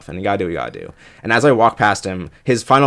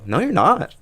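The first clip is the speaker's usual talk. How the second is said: light mock retort pitched higher; high teasing denial; 9 semitones higher than usual. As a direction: tease him with a high "you're not". Answering stranger in game.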